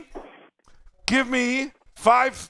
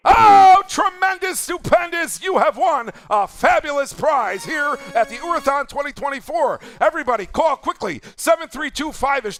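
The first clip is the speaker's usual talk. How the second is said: game show announcer; 4 semitones higher than usual. Acted. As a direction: sell the prize like a TV host. Host announces the prize.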